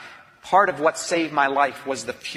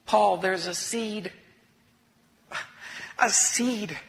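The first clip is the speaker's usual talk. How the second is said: tender; 5 semitones higher, much slower than usual. Retold or imitated. Friend's tender reassurance.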